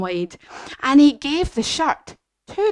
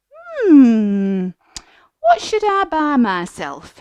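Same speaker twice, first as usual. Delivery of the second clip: musing George; 3 semitones higher, 5 dB louder, much slower than usual. Acted. George wondering in the shop.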